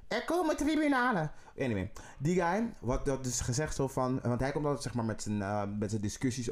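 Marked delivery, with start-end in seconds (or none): 0.1-1.3 mocking him in a squeaky falsetto; very high voice. Mocking a politician's very high voice in a falsetto. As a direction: pitch way up to mimic the politician's thin voice. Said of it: "met die hele hoge stem".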